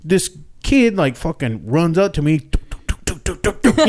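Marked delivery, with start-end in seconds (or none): 2.4-3.9 quick clicky footstep noises; cartoon footsteps sound effect. Shown as a demonstration. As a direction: tap out running footsteps with the tongue. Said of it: "the footsteps".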